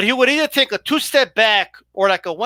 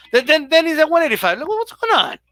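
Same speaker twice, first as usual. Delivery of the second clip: baffled; 7 semitones higher than usual. Real, not acted. Baffled by the fighter's weight changes.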